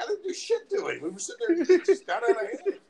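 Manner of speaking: very high, indignant self-defence; hammed-up flustered excuse; mock defensive protest. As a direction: protest your innocence in a high voice. Retold defence against accusing coworkers.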